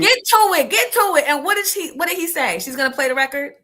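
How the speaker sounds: loud, high, impatient urging; impatient heckling. Real, not acted. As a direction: cut in loudly to hurry the story along. Impatient listener heckles the storyteller.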